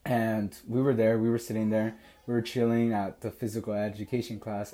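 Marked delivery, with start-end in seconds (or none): none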